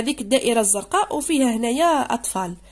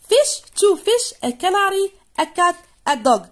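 slow, higher and louder, each pet named; slow loud listing; 7 semitones higher, 6 dB louder, much slower than usual. Shown as a demonstration. Teacher reading the pets list aloud, slow and loud. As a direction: spell out the pets slowly. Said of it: "reading list to class".

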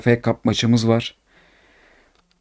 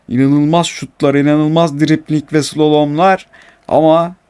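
normal talking voice

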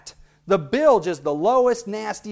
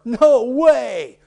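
surprised exclamation; 6 semitones higher, 7 dB louder than usual. Shown as a demonstration. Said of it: "we might say".

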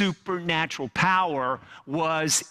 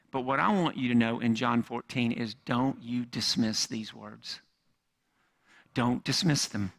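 ordinary talk

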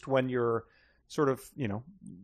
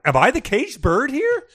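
self-absorbed listener; 11 semitones higher, 5 dB louder than usual. Retold or imitated. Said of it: "you're sitting there being like".

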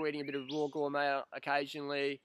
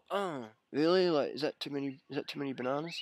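feigned innocence. Retold or imitated. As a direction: play dumb about the bananas. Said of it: "And I was like".

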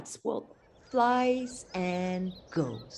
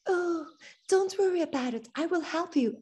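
put-on higher, gentle girl's voice; kind Thumbelina; 8 semitones higher than usual. Thumbelina comforts the injured swallow, a lightly raised gentle voice.

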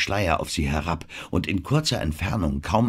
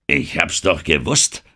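plain reading voice

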